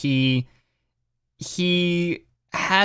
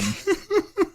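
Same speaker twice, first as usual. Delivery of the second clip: squealing laugh. Real, not acted.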